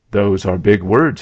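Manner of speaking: usual speaking voice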